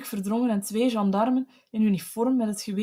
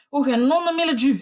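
higher, louder furious curse; sudden scream; 5 semitones higher, 6 dB louder, faster than usual. A narrator's reading. Husband bursting out at his wife, screaming a curse. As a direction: explode into a cursing scream. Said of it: "schreeuwde hij eensklaps".

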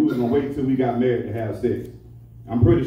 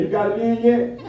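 wife asking; 8 semitones higher than usual. Retold or imitated. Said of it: "She said".

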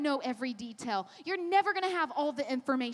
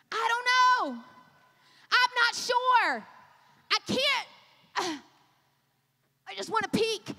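high, drawn-out put-on reluctance; mock anxious whining; 4 semitones higher, 3 dB louder than usual. Mimicking believers hesitating at the door, mock anxious. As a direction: balk in a hammed-up plea.

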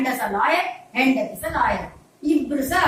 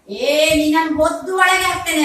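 raised, higher mock police threat; threatening policeman; 5 semitones higher, 7 dB louder than usual. Policeman father threatens crying baby with lock-up, loud mock threat. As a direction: scold like a cop threatening lock-up. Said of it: "ಅಂತ ಹೇಳ್ತಾನಂತೆ police".

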